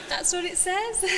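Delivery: high, loud laughing words. Real, not acted. Laughing at a confusing quote.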